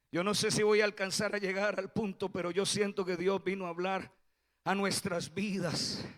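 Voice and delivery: usual speaking voice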